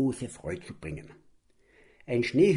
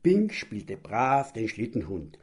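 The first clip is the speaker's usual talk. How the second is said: ordinary delivery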